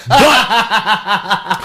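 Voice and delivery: high, loud laugh-talk. Real, not acted. Telling his friend the story, laughing loud and high. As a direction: laugh through the words.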